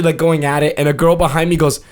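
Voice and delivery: ordinary talk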